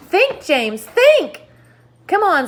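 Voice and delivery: high, loud urgent pushing; urging. Acted. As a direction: press James hard to think. Centipede urges James.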